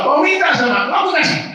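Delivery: high, loud proclamation; loud, lifted voice of the promise; booming divine promise. Acted. Voicing God's promise.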